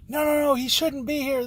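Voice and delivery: high, agitated mock protest; imitated yelling. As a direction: imitate a worked-up woman objecting. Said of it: "she's yelling and getting crazy".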